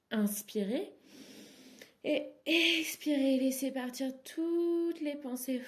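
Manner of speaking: rising inhale cue, then a high, loud, released exhale cue; breathing cue. Shown as a demonstration. Teacher having listeners release their thoughts, emphatic breathing cue. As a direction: push the words out as if letting the breath go. Coaching the second breath aloud.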